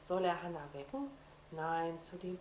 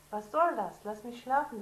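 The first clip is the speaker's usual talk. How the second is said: grumpy protest; 4 semitones higher, 9 dB louder than usual. Acted. Sister grumpy at being woken.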